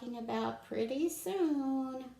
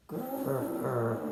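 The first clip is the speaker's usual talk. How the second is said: growl; 6 semitones lower, 5 dB louder than usual. Pretend. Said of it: "Can you all say".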